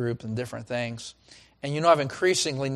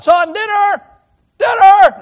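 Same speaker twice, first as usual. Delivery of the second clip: much higher, much louder call to dinner; mom calling out; 15 dB louder, slower than usual. Retold or imitated. Mother calling her plugged-in son to dinner, loud call. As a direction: shout for the boy across the house.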